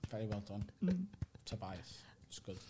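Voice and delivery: soft, low polite clapping voice; muted polite praise. Retold or imitated. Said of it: "it's all like".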